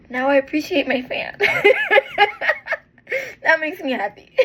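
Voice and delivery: real emotional laugh breaking through; laughing and crying. Real, not acted. Laughing and tearing up over her fan to viewers. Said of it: "I got a little emotional".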